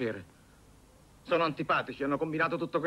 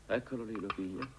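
muttered asides; 7 dB quieter than usual. Acted. Asides while aunt sees mess.

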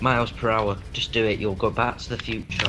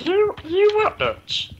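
much higher and louder, mocking; squeaky mock retort; 7 dB louder, slower than usual. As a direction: squeal it back at him.

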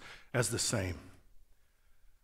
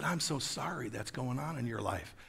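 quietly offered condolence; retold gentle apology; soft sympathy; 8 dB quieter than usual. Soothing reply to ranting man.

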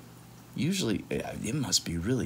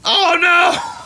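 high, loud scandalised cry; shocked gasp; 11 dB louder than usual. Acted. Reader shocked the boy drew his partner as a pig. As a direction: react to the pig drawing in horror.

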